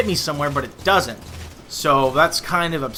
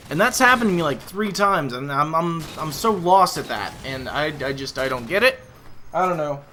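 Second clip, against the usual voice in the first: usual speaking voice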